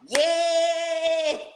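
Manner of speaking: long, high, loud cheer; excited yell. Retold or imitated. Viewer cheering at Stormy's conference on screen, excited yell. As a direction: stretch out a high, hyped "yes" yell. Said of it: "I was yelling and stuff".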